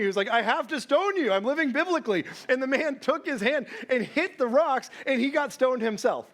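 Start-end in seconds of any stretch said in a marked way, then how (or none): 0.3-2.3 high, excited insistence; mock-earnest excitement; eager insistence. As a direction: push it gleefully, a touch hammed-up. Acting out the eager stoning.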